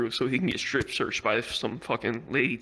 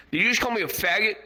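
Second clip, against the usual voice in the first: high, offended challenge; mock outrage; 7 semitones higher, 3 dB louder, faster than usual. Crackhead confronts airport officer over misheard insult, mock outrage. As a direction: imitate someone affronted and confrontational.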